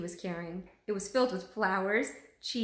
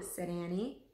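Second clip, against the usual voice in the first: plain reading voice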